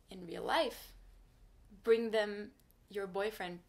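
normal talking voice

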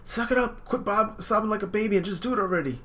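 big sister pushing Sanji to leave; stern sister. Acted. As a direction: order him onto the ship, high and sharp. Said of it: "Sister's like".

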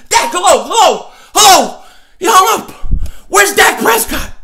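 high, loud searching calls; mock frantic calling. Host shouting for missing Dak, high loud calls. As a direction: call out for him desperately.

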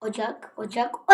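softly said, short OCAK; understated, muted word. Pretend. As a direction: say "ocak" quietly, holding back. Same word repeated, then shouted.

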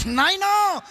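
cling to him, promise to follow; sin calling out to the dying father in a high, drawn-out voice; eerie clinging voice. Acted.

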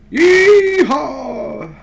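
loud high cowboy whoop; cowboy yeehaw. Pretend. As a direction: holler it like a rodeo cowboy. Cowboy hat avatar, whoop.

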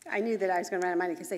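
ordinary talk

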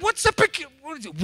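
ordinary talk

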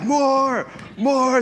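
high-pitched imitation of an aggressive toddler; demanding toddler. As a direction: mimic your little boy hollering for food. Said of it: "he's just so aggressive".

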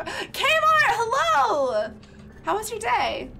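high loud happy hello to a viewer; excited greeting. Real, not acted. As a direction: call out a welcome.

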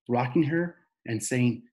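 plain reading voice

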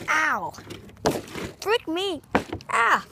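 rising yelps of pain. Real, not acted. Hurt himself.

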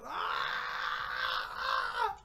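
high, drawn-out "aah" of despair; anguished scream. Real, not acted. Player wailing over a bad pack, anguished scream. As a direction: cry out high and long.

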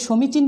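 সমীচীন with rounded o, "sho-michin"; Bangladeshi closed o. Shown as a demonstration. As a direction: say সমীচীন with first vowel closed to o. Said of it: "একটা ওকার দিয়েছি".

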